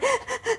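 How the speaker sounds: small high sobbing gasps; high, soft catch of breath; post-crying shuddery sigh. Retold or imitated. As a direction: imitate the little hiccup after crying. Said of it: "todavía como el suspirito este".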